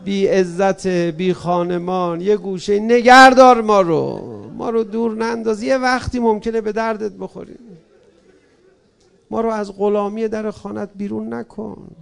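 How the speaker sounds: loud, begging lament; pleading prayer. Acted. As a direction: plead as a lowly servant at the door. Preacher pleads to Imam Hussein.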